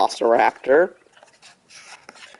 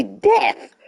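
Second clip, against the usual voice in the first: voice crack. Real, not acted. Streamer reading out injury effects, voice cracking. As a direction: voice cracks sharply upward on the word. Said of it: "my voice cracked so badly".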